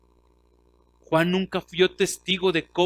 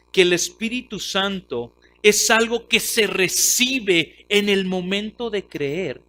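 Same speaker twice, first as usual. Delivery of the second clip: ordinary talk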